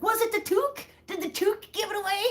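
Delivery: high, quick, eager Canadian; excited tourist. Retold or imitated. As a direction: pitch it up and rush it like him. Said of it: "he was like".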